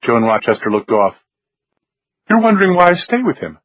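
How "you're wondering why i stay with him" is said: higher woman's voice, lightly voiced. A narrator's reading. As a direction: lift into Joan's voice. Joan's voice in narration.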